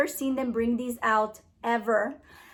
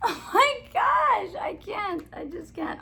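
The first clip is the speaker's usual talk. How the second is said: helpless with laughter; 8 semitones higher than usual. Real, not acted. Vlogger giving up on a tricky name, laughing. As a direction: gasp it out while cracking up.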